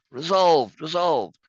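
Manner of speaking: high, loud acted chorus; crowd's rallying cry. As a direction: call it out as the whole crowd. Reads all citizens' answering cry.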